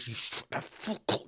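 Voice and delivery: whispered, menacing order to be quiet; hushed telling-off. Acted. Viewer silencing anyone talking during the show, hissed whisper. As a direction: whisper it through tight-held irritation. Hushing talkers during tense drama.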